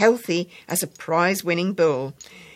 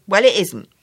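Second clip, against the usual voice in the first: put-on a young girl's raised voice, flatly correcting; a small child insisting, louder; firm little girl; 4 semitones higher, 9 dB louder than usual. Child contradicts the narrator.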